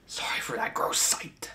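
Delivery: breathy whispered apology; whispering. Real, not acted. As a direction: whisper it, a little embarrassed. Apology right after chugging.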